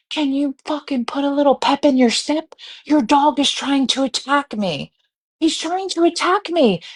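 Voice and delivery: high-pitched, indignant plea; urgent, exasperated demand; frantic exasperation. Retold or imitated. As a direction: reenact pleading with a careless dog owner, pitch climbing. Acted frantic plea to dog's owner.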